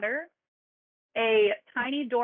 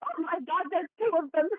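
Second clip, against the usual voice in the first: shocked; 7 semitones higher than usual. Acted. Hare shocked at two Hatters.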